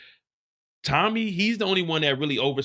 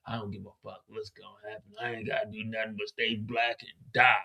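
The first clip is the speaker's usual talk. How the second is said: Diamond's voice; 3 semitones lower, 7 dB quieter than usual. Retold or imitated. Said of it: "She said".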